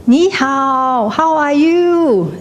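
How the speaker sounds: high, drawn-out calling out; cliff-top shout. Acted. Someone on top of a cliff greeting the world, shouting. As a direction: call it slowly across a valley. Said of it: "you shout".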